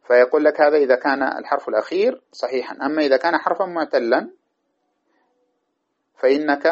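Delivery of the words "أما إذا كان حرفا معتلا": normal talking voice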